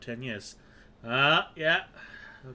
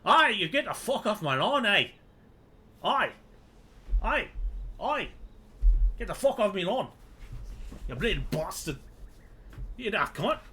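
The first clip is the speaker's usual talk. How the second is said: loud, high hammed-up British yob; cartoon British heckler shouting off trespassers; mock British accent; 11 semitones higher, 7 dB louder than usual. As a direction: yell abuse in a muddled British accent. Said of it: "shut I before he says it".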